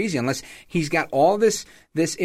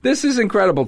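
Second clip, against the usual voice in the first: laughing; 11 semitones higher, 4 dB louder than usual. Real, not acted. Laughing at the clip.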